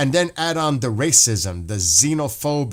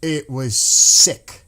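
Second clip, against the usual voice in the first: slow, louder, disgusted outburst; 7 dB louder, much slower than usual. Real, not acted. To viewers about the festival attack, slow disgusted outburst. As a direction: spit out the words slowly in disgust.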